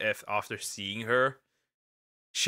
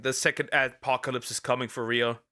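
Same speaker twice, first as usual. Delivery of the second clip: usual speaking voice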